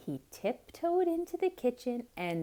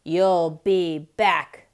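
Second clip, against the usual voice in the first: slow, louder angry growl; stormed; 3 semitones lower, 10 dB louder, slower than usual. Acted. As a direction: storm it out at the duck. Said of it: "stormed the cat".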